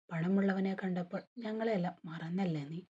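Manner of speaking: ordinary delivery, nothing marked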